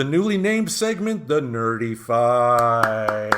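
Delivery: very slow, drawn-out segment announcement; announcer voice. Acted. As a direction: announce it like a show title.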